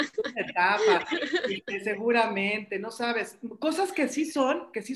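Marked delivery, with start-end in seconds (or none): 0.0-1.1 laughing as she repeats it; a wry laugh through the words. Real, not acted. Mother laughing at the "it's a phase" line, amused.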